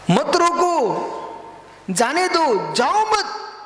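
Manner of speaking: high, forceful preaching commands; emphatic exhortation. Real, not acted. Preacher repeats maxim at raised pitch.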